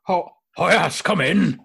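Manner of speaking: put-on high, loud old clerk; older man voice. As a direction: call out like a fussy old man. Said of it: "This sounds very familiar".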